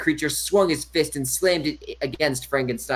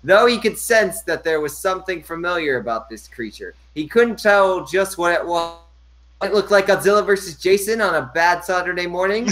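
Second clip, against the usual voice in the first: normal talking voice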